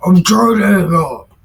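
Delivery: laughing exasperation while ending the video; exasperated outburst. Real, not acted. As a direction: lose patience with the dog. Frustrated, dog interrupting.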